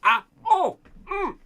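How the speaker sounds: high strained grunts, pushing hard; straining at a stuck lid. Real, not acted. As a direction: heave at a lid that won't budge. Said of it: "I can't get the lid off with my thumb".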